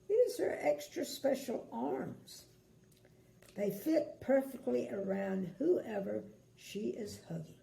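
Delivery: plain reading voice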